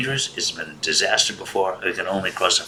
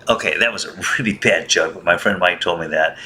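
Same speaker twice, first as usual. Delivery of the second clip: ordinary talk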